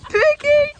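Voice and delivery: calling out. Real, not acted. Calling horse across paddock.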